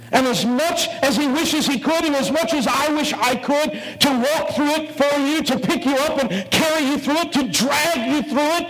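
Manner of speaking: high, urgent sermon climax; the pastor pleads with the congregation at full pitch; preaching at full force. Real, not acted. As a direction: pour everything into each rising phrase.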